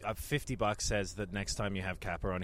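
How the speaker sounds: ordinary talk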